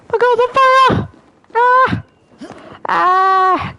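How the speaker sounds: high panicked yells at the fire; real panic, loud and high; panicked shouting. Real, not acted. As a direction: shriek as the flames close in.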